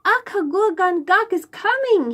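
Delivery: high, loud cry of alarm; the smallest boy yelling to warn the others; boy shouting a warning to the children; shouted warning. A narrator's reading. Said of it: "he shouted".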